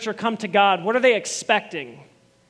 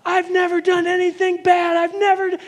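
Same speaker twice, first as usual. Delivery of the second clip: put-on high, whiny kid protesting innocence; worked-up kid; 10 semitones higher, 4 dB louder than usual. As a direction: play a worked-up child insisting he's never done wrong. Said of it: "You get a kid who's all worked up".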